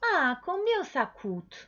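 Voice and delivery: high-pitched, slow customer question; customer asking. Acted. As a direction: ask the price like a curious customer, drawn out. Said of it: "el cliente te pregunta".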